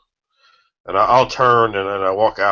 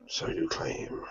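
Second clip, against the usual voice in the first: whisper; 15 dB quieter, slower than usual. Acted. Repeats line as eerie whisper.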